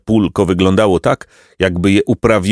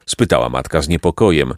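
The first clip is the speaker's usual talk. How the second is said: ordinary delivery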